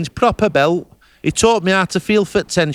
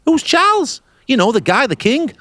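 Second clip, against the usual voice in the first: eager, higher-pitched questions; lightly imitated curious locals; 5 semitones higher than usual. Texans asking the English visitor about Charles, curious. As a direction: ask it like a wide-eyed local. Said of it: "they're going".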